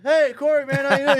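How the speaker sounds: high, loud excited hail; hearty greeting. Retold or imitated. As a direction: greet an old buddy at full volume. Security greets him warmly instead of refusing.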